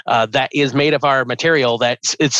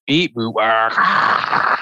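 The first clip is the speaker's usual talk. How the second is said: slow beeping modem screech; dial up modem noises; much slower than usual. Shown as a demonstration. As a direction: imitate an old dial-up modem. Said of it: "can you impersonate an old school modem sound?".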